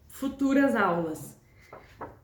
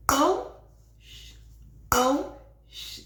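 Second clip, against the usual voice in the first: spoken rhythm with shush; 3 semitones higher than usual. Shown as a demonstration. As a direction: say pão, then hush the rest. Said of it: "ficaria, por exemplo".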